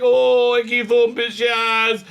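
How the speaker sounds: high, loud, dreamy reply; hammed-up Doctor. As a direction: answer as the smitten Doctor. Doctor answering Jens.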